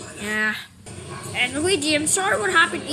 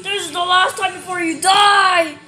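loud, angry warning; threatening shout; 7 dB louder than usual. Acted. Defending a friend from an attacker, threatening shout.

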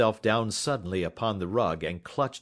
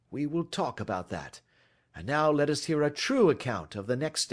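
lightly voiced detective, firm and controlled; interrogating detective; 9 semitones higher, 3 dB louder than usual. Detective demands a true account from culprit, lightly voiced authority. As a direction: press him for the truth.

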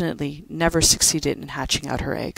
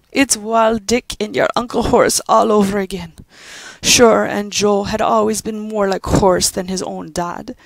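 put-on old Irish servant comforting a child; consoling Irish housekeeper; 5 semitones higher, 5 dB louder than usual. Judy comforting weeping Pat in a brogue.

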